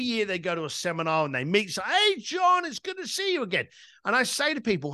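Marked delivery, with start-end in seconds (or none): 1.8-3.7 high-pitched, gushing hello; hearty acted greeting. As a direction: greet an acquaintance like a long-lost pal. Acted reunion greeting at a seminar.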